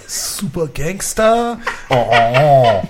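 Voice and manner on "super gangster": slow, high mock French accent. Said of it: "Can you say that in a French accent".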